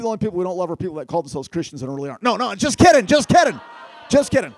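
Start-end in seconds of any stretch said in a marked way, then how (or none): 2.2-4.5 loud just kidding. Real, not acted. Joke retracted to the crowd.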